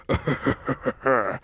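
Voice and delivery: villain's cackle; demented cartoon laughing. A narrator's reading. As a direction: cackle like a mad scientist. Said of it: "cackled his usual demented cackle".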